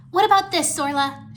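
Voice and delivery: high, loud eager question; lit up. A narrator's reading. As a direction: offer a sudden idea excitedly. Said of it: "Irene lit up, getting an idea".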